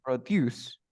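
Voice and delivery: Produce said pro-DUCE; stress on final syllable. Shown as a demonstration. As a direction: push the second syllable of Produce. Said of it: "The stress goes at the end of the word".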